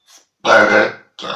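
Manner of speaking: a single burped word. Shown as a demonstration. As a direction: say "Sestra" on a burp. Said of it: "en rotant".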